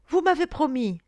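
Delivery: high, loud appeal; urgent reminder. A narrator's reading. Fisher girl as the lord says farewell, urgent. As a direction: insist anxiously on the promise. She reminds him of his promise.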